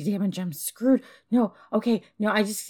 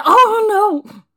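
loud, high, drawn-out dismay; alarmed cry; 10 semitones higher, 11 dB louder than usual. Acted. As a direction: cry out as the crash happens. Crashes the car again.

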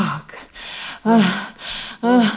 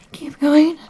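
higher and louder, pleading; urgent cry; 8 semitones higher, 6 dB louder than usual. Acted. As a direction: cry it out as she loses control. Urging at the peak.

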